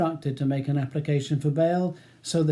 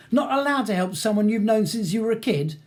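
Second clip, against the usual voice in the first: raised, high-pitched angry accusation; temper slipping; 7 semitones higher, 4 dB louder than usual. A narrator's reading. Ronnie accusing his childhood acquaintance, temper slipping. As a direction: accuse him, voice rising dangerously. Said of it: "his voice rising and his temper slipping dangerously".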